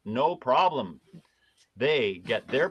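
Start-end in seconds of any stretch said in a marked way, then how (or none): 0.0-1.0 sarcastic approval. Acted. Excusing law enforcement over citizens, sarcastic approval. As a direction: wave it all away with mock agreement.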